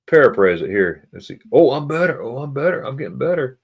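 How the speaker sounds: normal talking voice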